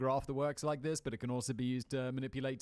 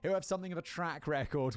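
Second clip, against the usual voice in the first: usual speaking voice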